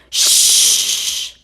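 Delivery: sharp hushing shh. A narrator's reading. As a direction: hush Duck at once. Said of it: "Goose hushed".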